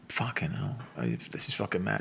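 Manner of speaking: slow, hushed inner voice; retold private thought. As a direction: say it under your breath, as if to yourself. Private thought looking at friend.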